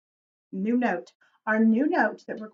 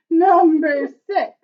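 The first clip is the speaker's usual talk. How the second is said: louder and slower with a laugh; 4 semitones higher, 8 dB louder than usual. Real, not acted. Teacher laughing as she announces number six. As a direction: laugh your way through the number.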